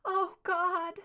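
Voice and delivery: the dread lands all at once; horrified gasp. A narrator's reading. Scully seeing what the doctor plans, horrified. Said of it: "Horror twisted her face".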